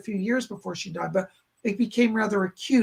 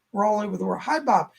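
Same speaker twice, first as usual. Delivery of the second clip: ordinary delivery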